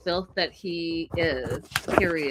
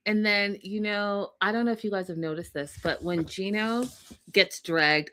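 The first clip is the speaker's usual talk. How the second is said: usual speaking voice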